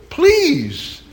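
high, loud relieved plea; acted pleading. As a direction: beg eagerly for the offered help. Mother begs stranger to change the tire.

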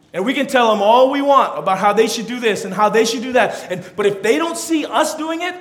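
high, loud, driving sermon voice; full-force preaching. Acted. Preacher urging parents to lead by example, full-force preaching. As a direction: press the point home to the congregation.

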